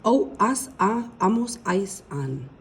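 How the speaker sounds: "O, as, a, amos" said crisply in Spanish; pronouncing the endings. Shown as a demonstration. Teacher models AR endings for students, crisp Spanish. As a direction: model each ending for the class. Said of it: "pronouncing them".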